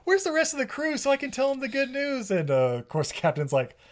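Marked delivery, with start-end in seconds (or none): 0.0-2.3 much higher, bubbly gushing voice; super perky. Retold or imitated. Claire telling the captain her good news, super perky. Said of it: "she's super perky".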